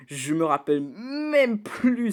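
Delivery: embarrassed laugh while confessing. Real, not acted. Reviewer laughing as he admits he forgot the song.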